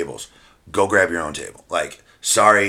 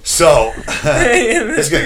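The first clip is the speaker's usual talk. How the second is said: laughing; 8 semitones higher, 6 dB louder, slower than usual. Real, not acted. Laughing before the risky story.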